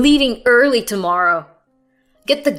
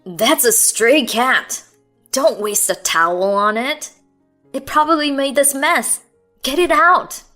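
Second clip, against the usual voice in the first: loud, rising irritation; irritated; 4 semitones higher, 7 dB louder than usual. A narrator's reading. Husband ordering wife to dump the cat, loud and irritated. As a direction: bark orders, fed up. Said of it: "Sam's irritated voice".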